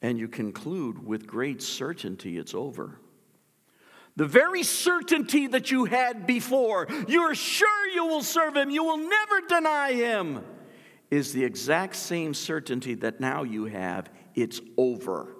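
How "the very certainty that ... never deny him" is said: very high, loud ringing declaration; preaching shout. Acted. Preacher confronting listeners' false certainty, high loud shout.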